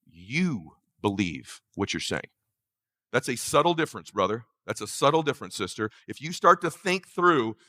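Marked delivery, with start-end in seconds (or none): 0.1-2.3 low, soft, slow punch on you; slow emphatic reveal. Real, not acted. Coach revealing the key line, low slow emphasis. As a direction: drop it slow, stressing you.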